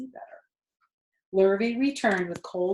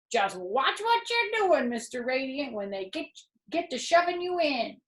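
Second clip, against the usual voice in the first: goose voice; 7 semitones higher than usual. A narrator's reading. Goose warning Wilbur about the crate. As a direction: warn him like a fussy old goose.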